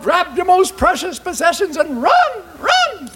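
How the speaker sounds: high-pitched urgent yell. Acted. Men yell to townsfolk fleeing the giant, high urgent yelling. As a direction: call out a high alarm, climbing on the last "run". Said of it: "Men yelled".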